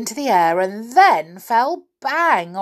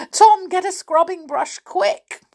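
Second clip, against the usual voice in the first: urgent; 12 semitones higher, 5 dB louder than usual. A narrator's reading. Urgent order.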